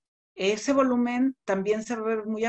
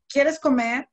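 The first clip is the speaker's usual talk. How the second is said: higher and louder, an inviting question; friendly offer; 6 semitones higher, 6 dB louder, slower than usual. Shown as a demonstration. Teacher demos a cheerful offer to eat. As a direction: offer the food warmly. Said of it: "no es lo mismo decir".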